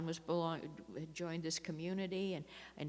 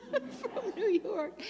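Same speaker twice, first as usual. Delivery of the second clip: higher, slow, laughing through the words; slower than usual. Real, not acted. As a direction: let the laugh carry the phrase. Laughing at her own story.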